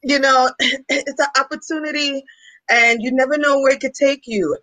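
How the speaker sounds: put-on Caribbean accent. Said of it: "with her heavy Caribbean accent".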